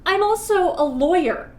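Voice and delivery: high, loud exasperated correction. Acted. Witch correcting her nervous guest, exasperated. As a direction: insist on it, out of patience.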